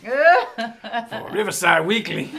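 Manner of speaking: put-on slow, loud old man host; host the show as an old man. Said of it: "Let me get an old man character".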